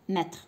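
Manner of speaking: ماتر with a middle vowel; teacher modelling mettre; correct pronunciation. Shown as a demonstration. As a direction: say ماتر, not the wrong forms. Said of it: "ما نقولش ماشي متر وماشي ماتر".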